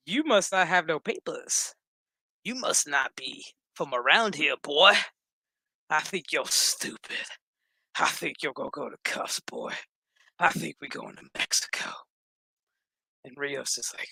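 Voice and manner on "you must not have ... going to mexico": menacing cop. Acted. Cop who ain't stupid to a suspect, drawled menace.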